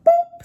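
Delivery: high, loud "boop" noise; sudden squeaky sound effect; playful boop. Pretend. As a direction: pop out a high-pitched boop. Mimics the dropping sound.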